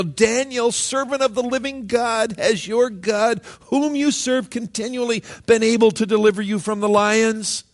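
a king's anguished call into the den; lamenting call. A narrator's reading. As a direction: cry out to Daniel in anguish. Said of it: "cried out with a lamenting voice".

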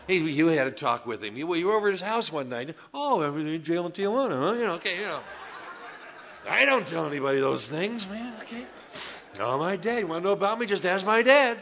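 loud, laughing, embarrassed telling; laughing confession. Real, not acted. Pastor laughing with congregation about his dad, loud. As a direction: laugh through the admission.

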